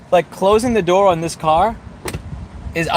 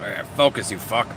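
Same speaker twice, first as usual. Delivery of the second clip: put-on lower, softer Canadian accent with an eh; mock Canadian telling the camera off; light Canadian accent curse; 7 semitones lower, 5 dB quieter than usual. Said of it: "with a Canadian accent".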